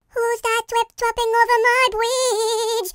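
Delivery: high, soft troll voice; squeaky troll. Acted. Troll voice repeated higher.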